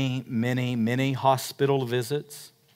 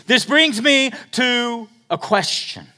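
preaching; 12 semitones higher, 8 dB louder, slower than usual. Acted.

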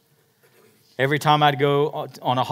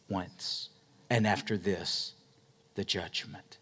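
tender to dad; 4 semitones lower than usual. Retold or imitated. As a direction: speak gently to a dying father. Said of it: "I remember telling my dad".

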